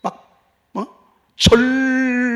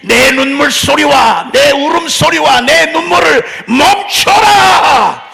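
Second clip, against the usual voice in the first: loud, slow, higher divine command; voice of the Lord commanding; 6 semitones higher, 10 dB louder, slower than usual. Acted. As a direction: thunder God's order to stop crying. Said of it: "주님이 그래서".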